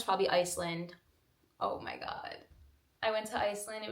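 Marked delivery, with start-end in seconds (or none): 1.6-2.4 breathy whisper. Real, not acted. Remembering Iceland.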